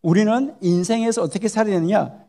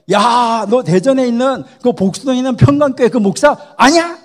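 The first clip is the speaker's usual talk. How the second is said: loud, higher excited recognition; God's loud exclamation; 3 semitones higher, 7 dB louder than usual. Acted. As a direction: call it out as God recognizing the pastor. God recognizes the Daejeon pastor.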